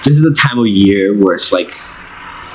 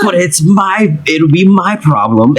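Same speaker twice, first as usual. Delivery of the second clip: high, protesting sibling voice; exasperated brother; 9 semitones higher than usual. Acted. Older brother afraid of getting blamed by mom, exasperated. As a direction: protest at a raised pitch. Brothers argue over butter knife.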